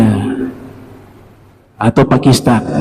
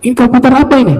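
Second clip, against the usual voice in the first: mock scolding; 8 semitones higher, faster than usual. Teacher interrogates the boy.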